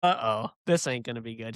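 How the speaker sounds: low, understated uh-oh; wry foreboding. Retold or imitated. Teammates spotting Lindsay shaking her head, low foreboding. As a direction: sense trouble coming, said low and dry.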